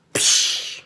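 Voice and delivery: breathy psh of water splashing; a quick unvoiced splash noise; splash sound. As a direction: make the splash of the water jug. Water poured over her head.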